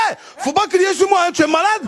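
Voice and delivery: Ivorian temper. Retold or imitated. Comedian snaps at the guard, loud Ivorian retort. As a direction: snap back, Ivorian style. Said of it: "mon côté ivoirien qui est remonté".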